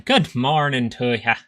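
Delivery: a hammed-up Irish greeting, drawn out; Irish accent. Streamer tries an Irish greeting for chat, slow mock Irish accent. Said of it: "Or is that Irish?".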